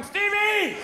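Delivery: high, loud mock call. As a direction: imitate someone hailing a friend across the room. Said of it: "there's W going".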